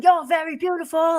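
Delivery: mock British accent, pitched high; put-on British accent, hollering a compliment. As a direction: imitate a British admirer, high-voiced. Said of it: "he had this British accent".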